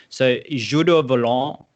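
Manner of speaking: slow, proud French accent. Shown as a demonstration. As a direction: say it like a native of France. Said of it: "that's a terrible French accent".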